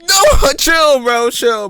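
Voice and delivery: loud high laughing protest; cracking up, telling them to chill. Real, not acted.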